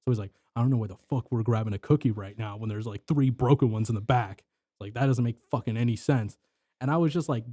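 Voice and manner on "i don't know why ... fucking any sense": ordinary talk, nothing marked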